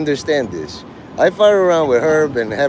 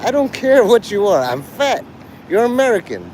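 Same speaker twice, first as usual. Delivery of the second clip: raised-pitch comedian bit, lightly imitated; Ralphie May; 7 semitones higher than usual. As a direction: retell a stand-up's emphatic declaration. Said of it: "I watched a Ralphie May comedy".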